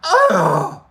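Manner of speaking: loud, high hammed-up yelp; mock cry of pain. Patient reacts to needle, exaggerated high cry. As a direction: yelp loudly as if jabbed. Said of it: "I cried out".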